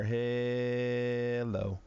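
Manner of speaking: ordinary talk, nothing marked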